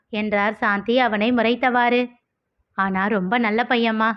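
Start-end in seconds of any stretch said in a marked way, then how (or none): none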